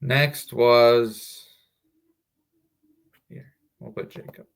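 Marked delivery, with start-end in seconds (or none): 3.3-4.5 whispered. Real, not acted. Muttered off-mic aside.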